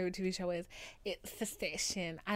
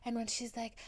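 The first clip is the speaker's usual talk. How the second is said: usual speaking voice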